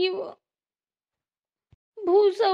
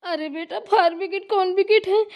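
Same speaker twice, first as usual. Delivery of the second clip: ordinary talk, nothing marked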